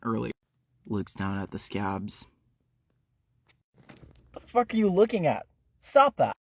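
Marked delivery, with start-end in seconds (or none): 4.3-6.3 high, loud and fast; angry snap. Acted. Ephraim snapping at Shelly staring at his hand, angry snap. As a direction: cut him off sharply.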